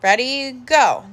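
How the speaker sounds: usual speaking voice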